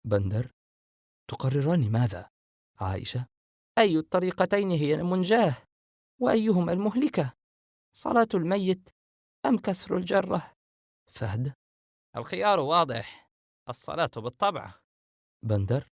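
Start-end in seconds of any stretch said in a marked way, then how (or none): 3.8-10.5 lightly raised female voice, measured; a hint of a worried sister retelling advice; Aisha's voice. Aisha poses the choice.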